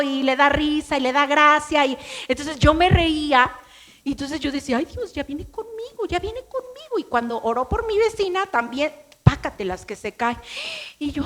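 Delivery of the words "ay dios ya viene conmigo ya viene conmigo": high, hushed frightened gasping; nervous panic. Retold or imitated. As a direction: squeak it out, scared it's your turn. Adolescente asustada, se acerca predicador. (pánico agudo y contenido)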